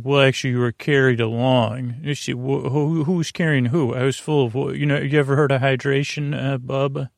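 ordinary delivery

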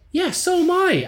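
high-pitched, exasperated comeback; indignant retort. Retold or imitated. Gig-goer to the man drumming on his back, indignant. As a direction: snap back, pitch jumping well up. Retold argument with annoying drummer.

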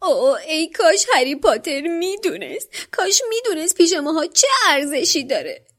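high, whining elf voice that breaks into crying; a miserable little elf wailing in tears; wailing house-elf. A narrator's reading. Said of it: "ناله کنان گفت". (جن خانگی با صدای زیر و ناله و گریه)

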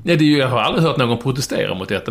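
ordinary talk

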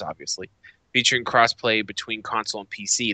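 usual speaking voice

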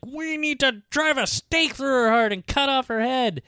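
very high mock-mob demand; agitated mob imitated. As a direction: ham up villagers calling for action. Acting out the Germans' demand.

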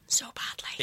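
breathy whisper of longing. Real, not acted. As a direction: whisper it like a secret wish.

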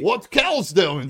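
high, loud, slow bro yell; Delco bro shout. Retold or imitated. Mimicking Delco guys asking about Neilsy, loud high yell. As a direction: holler it like a rowdy Delco guy. Mocking Delco guys' talk.

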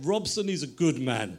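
put-on dad's Greek-accented voice. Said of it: "And he's kind of Greek accent".

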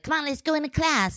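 high-pitched imitation of a little girl; child's voice. Mom voicing her daughter heading to class, high child voice. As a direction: pitch it way up, like a kid urging.

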